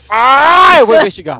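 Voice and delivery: loud, high outburst, mock exasperation; giving in. Retelling the Doctor giving in to investigate, comic loud outburst. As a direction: burst out as if caving to temptation. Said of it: "e o Doctor, "Eu não vou investigar".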